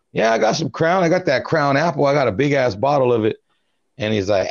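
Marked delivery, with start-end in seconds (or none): 0.1-3.4 high eager boasting; proudly pitched up, eager; excited. Retold or imitated. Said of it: "And I go".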